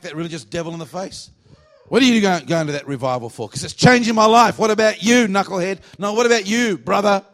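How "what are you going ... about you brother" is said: loud, high back-and-forth challenge; heated mock argument. Preacher acting out a quarrel over going to revival, loud and heated. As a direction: throw each retort back louder and higher.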